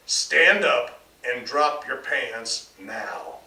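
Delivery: loud, slow, hard command; loud, slow demand to his son. A narrator's reading. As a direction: spell out every word, loud and slow. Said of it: "he demanded".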